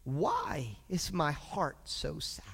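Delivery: slow, hushed, downcast question; quiet, drawn-out sorrow; sad lament. Acted. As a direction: sink into a soft, heavy-hearted near whisper. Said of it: "it says it like this".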